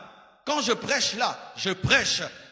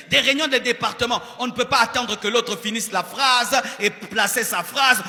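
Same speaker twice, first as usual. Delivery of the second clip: ordinary talk, nothing marked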